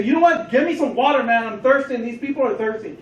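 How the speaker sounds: a gruff captor barking for water; demanding hostage taker. Retold or imitated. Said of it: "you might say".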